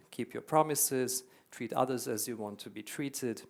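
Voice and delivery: normal talking voice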